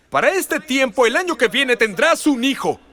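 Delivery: high, loud proclamation of the promise; proclaiming. Acted. The Lord promising Abraham a son, loud and high. God's promise voiced loudly.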